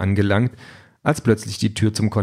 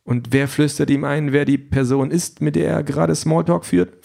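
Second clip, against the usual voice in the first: higher voice for the questioning woman; sceptical Aisha; 4 semitones higher than usual. Acted. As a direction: pitch it up, pressing with questions. Voices Aisha questioning the candidate's setup. (skeptische Frau, höhere Stimme)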